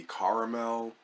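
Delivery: caramel with a CAR first syllable, three syllables; CAR-uh-mel pronunciation. Shown as a demonstration. Reviewer listing variants for viewers, caramel as CAR-uh-mel. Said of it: "there may be other pronunciations".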